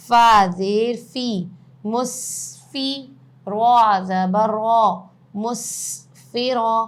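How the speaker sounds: spelling drill. Shown as a demonstration. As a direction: call out each letter and vowel for students to repeat.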